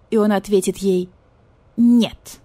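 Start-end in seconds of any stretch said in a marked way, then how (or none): 1.8-2.4 firm, curt refusal; cold and manly. Retold or imitated. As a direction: say no coldly. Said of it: "ответит мужественно и холодно".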